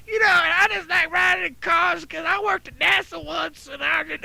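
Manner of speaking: high-pitched, far-from-normal rambling character voice; mock high, nonsensical chatter; rambling lady. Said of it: "this lady's not making much sense".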